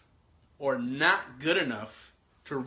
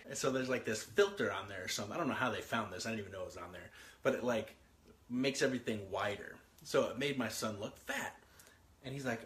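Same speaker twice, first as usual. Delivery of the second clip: ordinary talk, nothing marked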